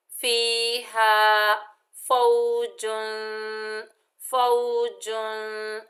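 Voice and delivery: slow, drawn-out recitation. Shown as a demonstration. Teacher reciting फीहा फौजुन for the class. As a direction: recite slowly. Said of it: "खींच कर पढ़ेंगे".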